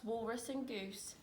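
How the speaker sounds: ordinary delivery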